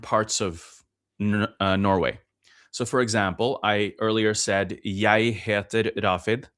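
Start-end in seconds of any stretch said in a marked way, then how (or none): 4.8-6.5 Oslo Norwegian, "Jeg" said "yai"; slow demo with "Jeg" rhyming with "eye"; Oslo pronunciation. Shown as a demonstration. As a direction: say "Jeg" as "yai", slowly, as in the capital. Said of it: "That's how a person in, in Oslo".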